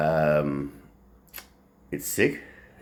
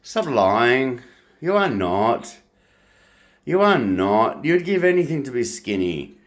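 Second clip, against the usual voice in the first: angry rant; 6 semitones higher, 4 dB louder than usual. Real, not acted.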